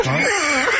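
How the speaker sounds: high laughing burst on the last word. Real, not acted. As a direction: collapse into laughter. Laughs at the thumbs research.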